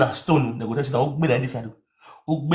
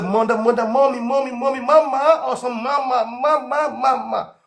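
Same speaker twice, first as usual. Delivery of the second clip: high mocking cries of mama; mock children calling; 8 semitones higher than usual. Mimicking kids calling for their mama, high and mocking. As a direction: lightly imitate kids wailing for mama.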